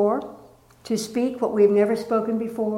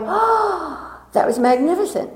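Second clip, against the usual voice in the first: "Oh" pitched very high, then breathless wonder; delighted exclaim; 7 semitones higher than usual. Retold or imitated. Surfacing from the dive, a delighted high exclaim. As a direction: gasp with joy after the jump. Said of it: "coming up and going".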